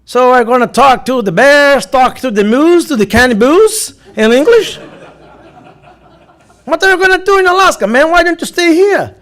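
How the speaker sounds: imitando o oficial americano, alto e espantado; oficial da imigração. Retold or imitated. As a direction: sobe o tom e solta o inglês do oficial, incrédulo. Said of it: "Aí ele olhou pra mim e disse". (imitação animada do oficial da imigração)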